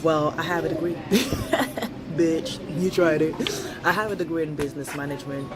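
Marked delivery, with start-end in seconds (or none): none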